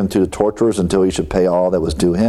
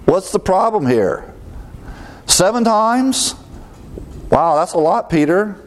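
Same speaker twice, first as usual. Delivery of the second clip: mock astonishment; 9 semitones higher than usual. Preacher teasing Peter, high mock amazement. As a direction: gasp at seven like it's outrageous.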